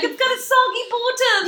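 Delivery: high, loud heckle; hammed-up armchair-judge yell; mock outrage. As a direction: cry it out at the screen. Mimicking viewers shouting at the baking show.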